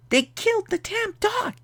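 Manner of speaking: extremely high falsetto lament; squeaky mock dismay. Repeats it, far higher, for effect.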